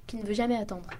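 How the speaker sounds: plain reading voice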